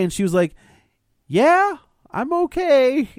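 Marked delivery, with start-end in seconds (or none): 1.3-3.1 very high, squeaky hesitant reply; hesitant wife. Retold or imitated. Wife answers her husband on the phone, high and hesitant. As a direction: put on a high, sheepish wife's voice.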